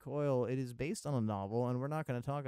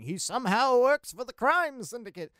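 higher, louder hammed-up mysterious voice; mock mysterious; 12 semitones higher, 12 dB louder than usual. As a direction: ham up the somehow like a big secret. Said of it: "I say somehow like it's gonna be some mysterious thing".

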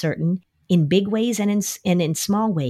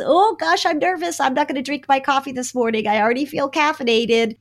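higher-pitched, lightly acted jitters; mock nervous; 7 semitones higher than usual. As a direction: model mild nerves aloud, as if to kids. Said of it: "I'm so nervous about this".

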